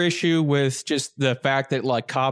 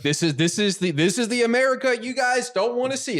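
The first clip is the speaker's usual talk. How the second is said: higher, lecturing the nation; mock preachy Cops show; 8 semitones higher than usual. The show Cops to its viewers, a mock sermon voice.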